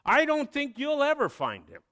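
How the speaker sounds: calling to a student at the door. Retold or imitated. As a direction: call after him as he leaves. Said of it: "and then called out".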